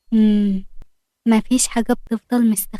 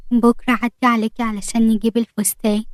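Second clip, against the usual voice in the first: plain reading voice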